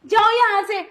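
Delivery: frightened scream for him to get off; panicked shout. Acted. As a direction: yell at him to let go of you. Bhabhi fights off devar.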